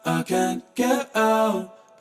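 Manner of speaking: ordinary talk, nothing marked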